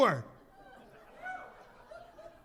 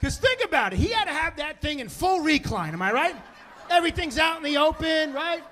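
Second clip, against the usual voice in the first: ordinary delivery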